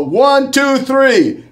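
high, rising count to three; pumped-up countdown. Real, not acted. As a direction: count it in with energy. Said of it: "you can't hold back".

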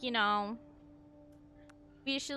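ordinary talk, nothing marked

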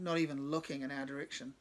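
ordinary delivery

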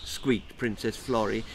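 ordinary delivery, nothing marked

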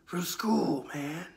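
eerie unvoiced whisper; creepy whisper. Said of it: "guttural, growly voices".

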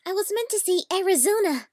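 high, loud and incredulous; exasperated self-correction. Real, not acted. Voice actor correcting their own flub, exasperated.

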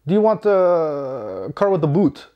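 a hint of a South African accent, retelling the clerk. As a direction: mimic the Afrikaner rental agent's accent, half-joking. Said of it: "they have South African, Afrikaner".

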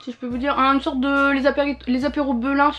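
eating a crunchy snack; talking while chewing. Real, not acted. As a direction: mouth partly full. Seen 0: Bites snack at 1.8s, then talks with lips pressed, chewing.

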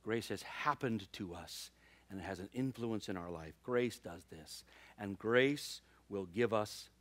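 slow, earnest sermon delivery; preaching. Acted. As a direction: preach it to the congregation.

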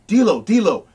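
imitating a friend shouting someone off a fight; urgent retold shout. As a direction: call over a scuffle to break it up.

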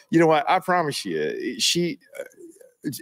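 ordinary talk, nothing marked

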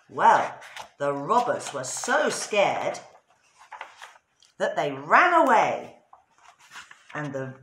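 ordinary delivery

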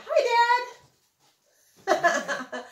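calling out. Real, not acted. Greets her dad off to side.

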